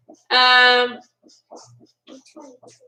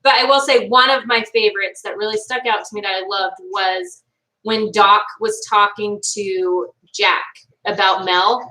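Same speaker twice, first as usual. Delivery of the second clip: ordinary talk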